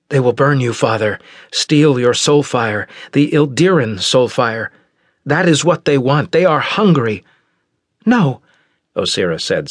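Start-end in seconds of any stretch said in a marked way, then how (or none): 0.1-7.2 put-on higher, louder tense young man; tense Darrow. Heir warning his father, tense and pressing. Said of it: "his voice reflected his tension". 8.1-8.4 high, loud objection; sharp no. Acted. Osira contradicting Darrow, high and sharp.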